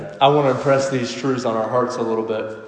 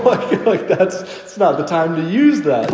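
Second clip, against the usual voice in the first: laughing through the words, higher and louder; 4 semitones higher, 5 dB louder than usual. Real, not acted. Pastor laughing at the funeral blunder, amused. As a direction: chuckle while pointing out the blunder. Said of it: "It's funny".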